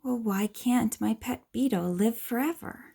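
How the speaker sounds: ordinary delivery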